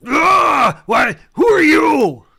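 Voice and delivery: very high, loud outcry; pained yell. Acted. Game master voices wounded enemy hit by dagger, yelling. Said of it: "he yells out".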